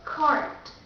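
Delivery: cart with the R sounded, American; American cart, pronounce the R; American accent. Shown as a demonstration. Teacher contrasting two accents for learners, American accent. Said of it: "The first will be American accent".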